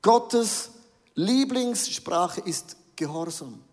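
Lieblingssprache drawn out slow with a firm fall; the quoted sentence spelled out slowly; slow emphatic quote. Shown as a demonstration. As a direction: lay weight on Lieblingssprache, slowly. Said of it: "Habe ich leider gehört, diesen Satz".

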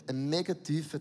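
ordinary talk

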